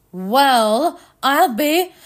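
loud, high astonished exclamation; loud amazement, rising in pitch; amazed cry. A narrator's reading. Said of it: "cried Matt, amazed".

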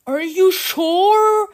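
high, loud and drawn out; alarmed robot leader, pitch climbing; fearful question. Acted. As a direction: ask slowly with mounting alarm. Frightened leader asks about monster.

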